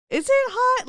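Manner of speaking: stunned by the compliment; incredulous. Real, not acted. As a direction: throw the question back in shock.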